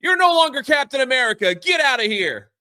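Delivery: high, loud furious retort; angry president. Retold or imitated. President kicks Sam out of the office, high angry shout. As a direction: throw him out in a rage. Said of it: "He goes".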